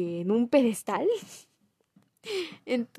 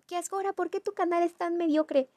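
mock viewer; 7 semitones higher than usual. Voicing a mock viewer question.

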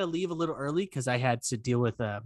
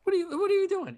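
high-pitched retold disbelief; mock indignation; faster than usual. Retelling a reply to the neighbor, high mock indignation. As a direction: lightly act out a baffled scolding.

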